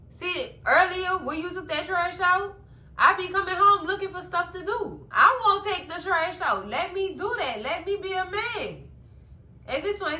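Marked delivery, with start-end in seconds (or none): 0.1-8.9 high, insistent pleading; retold begging. Zach asking to be the man, retold begging. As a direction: plead his case, pitched well up. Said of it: "it sounded like he was begging".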